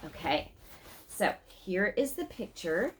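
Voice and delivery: plain reading voice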